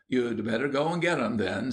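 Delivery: ordinary delivery, nothing marked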